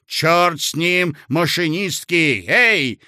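a blasting command; bellowing order. A narrator's reading. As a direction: call the typists over. Official bellows to typists.

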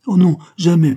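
high, loud burst of protest; daughter's eager cry to her father. A narrator's reading. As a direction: blurt it out with sudden vivacity. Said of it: "s'écria-t-elle avec plus de vivacité".

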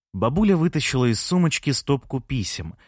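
plain reading voice

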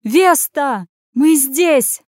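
high, loud hail to someone far off; calling out. A narrator's reading. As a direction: shout to be noticed. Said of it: "закричала Сабина и замахала руками".

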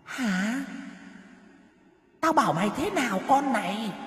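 high, eerie voice rising to a shout; a ghost berating the terrified woman; cold ghost voice. A narrator's reading. Ghost scolding Mrs Dậu for bringing another person, eerie high voice rising to a shout. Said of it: "Âm thanh lạnh lẽo".

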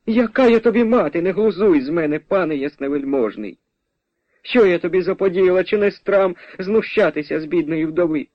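high-pitched peasant mother's protest; indignant mother. Acted. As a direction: scold the supposed impostor, offended. Mother indignantly rejects a stranger.

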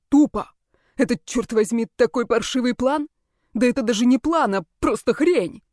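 rising to an angry shout; angry outburst. Acted. Blake's outburst building. (гневная вспышка, всё громче)

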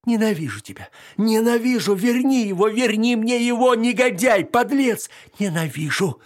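high, loud hysterical yelling; hysterical screaming. Acted. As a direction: scream the hatred out. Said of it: "крича несвязанные слова".

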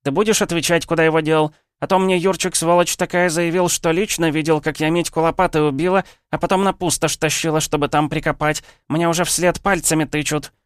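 high, loud brawling woman's voice, fists on hips; shrill village woman. Acted. Angry wife demands her missing man, high, loud yelling. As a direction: yell it at him like a market scold. Drunk woman ranting at hero.

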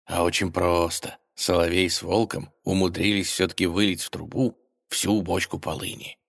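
usual speaking voice

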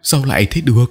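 high, disbelieving cry; stunned, high-pitched question; reeling in shock. A narrator's reading. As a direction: ask it as if staggered by bad news. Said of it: "Ông Lãng lảo đảo".

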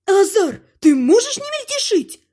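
high-pitched exasperated wife; irritated snarl. A narrator's reading. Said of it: "рычит любимая жена тёмного властелина".

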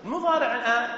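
ordinary talk, nothing marked

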